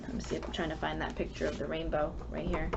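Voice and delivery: usual speaking voice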